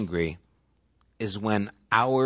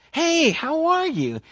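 much higher, slow, delighted hello; eager greeting. Retold or imitated. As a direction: sing out a welcome to a friend. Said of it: "they acknowledged you".

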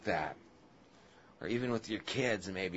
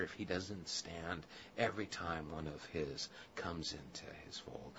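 whispered wonder; 7 semitones lower, 14 dB quieter than usual. Real, not acted. Tender wondering aside.

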